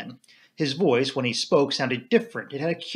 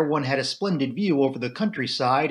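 plain reading voice

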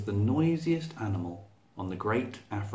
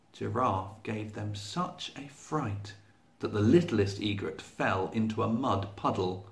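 usual speaking voice

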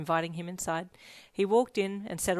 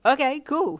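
bright, louder and higher, big grin; 8 semitones higher, 11 dB louder than usual. A narrator's reading. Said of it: "he said brightly with a huge grin".

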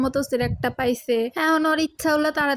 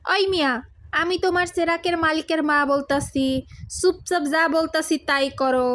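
loud, bossy village mother; 4 semitones higher, 5 dB louder than usual. Acted. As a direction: order the genie around. Mother orders the genie.

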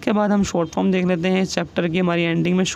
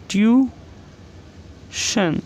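Tuition drawn out very slowly, a little higher; slow dictation; 4 semitones higher, much slower than usual. Shown as a demonstration. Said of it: "next example है".

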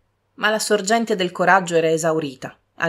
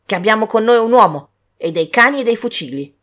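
louder, stern warning; threatening warning; 4 semitones higher, 8 dB louder than usual. Acted. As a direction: scare her off. Servant threatens beggar.

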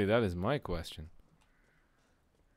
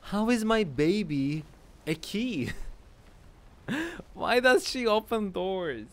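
baffled outburst; 9 semitones higher than usual. Real, not acted. Player questioning the game's absurd baby puzzle, baffled outburst. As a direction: question it in high-pitched bewilderment.

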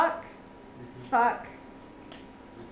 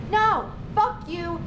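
emphatic no; 5 semitones higher, slower than usual. Real, not acted. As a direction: shut the idea down hard. Rejecting the synthetic ending.

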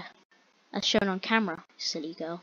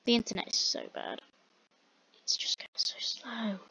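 a breathy whisper of frustration; whispered complaint; 10 dB quieter than usual. Real, not acted. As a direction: whisper it, annoyed at the lag. Whispered complaint about slow internet.

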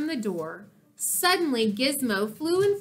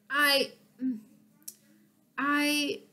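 hesitant, halting start with long pause; nervous; 3 semitones higher than usual. A narrator's reading. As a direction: falter before asking for help. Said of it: "felt more nervous than ever".

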